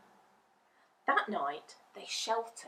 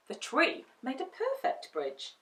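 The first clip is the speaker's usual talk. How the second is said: ordinary delivery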